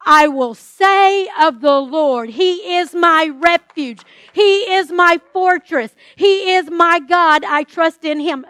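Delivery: loud, slow, declared proclamation; preaching at full force. Acted. Preacher declaring the psalm over the congregation, loud and slow. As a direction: proclaim each line like a banner. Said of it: "I'm not asking you to trust".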